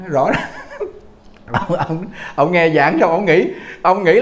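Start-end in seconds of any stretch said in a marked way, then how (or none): 0.4-1.0 soft airy little laugh; a quick breathy chuckle at the point; short unvoiced laugh. Real, not acted.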